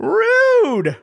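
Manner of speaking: high squeaky mock-offended rude; mock offense. Echoing co-host's Rude at the critic, squeaky mock offense. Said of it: "mock offended echo".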